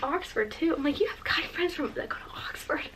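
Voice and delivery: hushed, awed whisper; stunned whisper. Retold or imitated. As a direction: whisper it in disbelief. Stunned whispering about Oxford friends.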